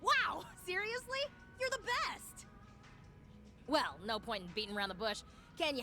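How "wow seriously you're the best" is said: high, gushing excitement; excited gushing. Acted. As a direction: squeal with delighted gratitude. Gushing thanks for agreeing to help.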